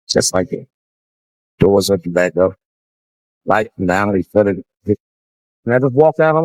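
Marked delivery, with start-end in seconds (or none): none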